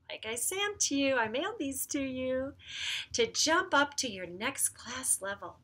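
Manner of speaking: ordinary talk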